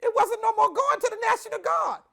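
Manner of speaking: high, rising panic in the telling; reliving panic, high-pitched and emphatic; freaked out. Retold or imitated. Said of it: "totally freaked out".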